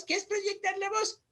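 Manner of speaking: ordinary talk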